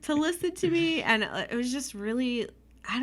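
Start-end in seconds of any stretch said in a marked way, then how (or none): 0.0-1.0 teary thanks. Retold or imitated. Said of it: "And I started crying".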